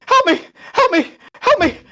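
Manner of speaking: high, loud frantic cries for help; panic attack. Acted. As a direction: repeat it in sharp desperate bursts. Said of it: "goes into a panic attack".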